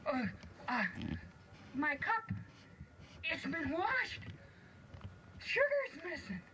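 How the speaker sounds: high, puzzled little dwarf; baffled dwarf. Acted. Dwarf finding his cup washed, high and baffled. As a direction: squeak out each discovery. Dwarfs find house cleaned.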